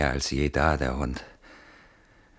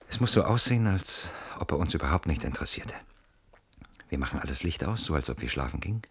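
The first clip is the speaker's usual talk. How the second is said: ordinary talk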